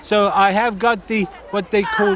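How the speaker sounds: lightly put-on Norwegian accent; a hint of a Norwegian accent; mock Norwegian accent. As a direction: play a bumbling Norwegian skier. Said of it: "like a Norwegian Frank Spencer".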